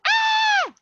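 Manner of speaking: put-on high piercing child's scream; kid scream. Child screaming and slamming the door, piercing high scream. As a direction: shriek like a furious little kid.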